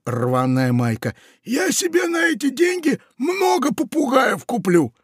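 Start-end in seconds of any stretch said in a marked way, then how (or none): 1.4-4.9 very high, shouted spite; pirate shouting. Acted. Pirate shouts at the parrot in a quarrel.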